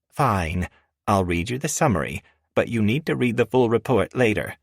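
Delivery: a hint of a firm assistant; professional woman voice. A narrator's reading. Miranda voiced by narrator.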